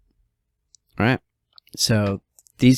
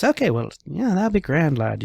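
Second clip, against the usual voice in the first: put-on higher-pitched, lilting Irish accent; 6 semitones higher than usual. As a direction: slip into a casual Irish accent. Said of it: "broke into an Irish accent".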